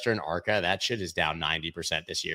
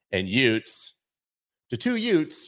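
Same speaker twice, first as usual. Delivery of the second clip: put-on My Cousin Vinny Brooklyn accent; 3 semitones higher than usual. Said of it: "Brooklyn accent I just did".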